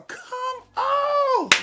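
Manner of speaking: a high hyped squeal of delight; excited squeal. Real, not acted. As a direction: come on, jumped way up high. Hyped reaction to comment.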